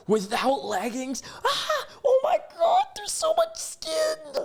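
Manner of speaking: hammed-up hysterical squeal; mock freak-out. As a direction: imitate someone scandalized, shrieking in falsetto. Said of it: "he's freaking out".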